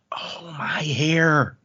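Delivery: breathless, slow whispered realization; shocked whisper. Acted. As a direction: gasp it out under the breath, slowly. Realizing her hair shows emotion.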